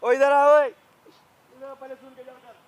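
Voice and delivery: loud call after someone leaving. Real, not acted. Husband shouts after his wife as she runs off. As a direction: shout to call her back.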